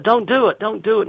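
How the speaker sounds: mock plea to stop, called across; calling out. Stranger calls to young man on bridge edge, pleading call. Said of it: "He calls out".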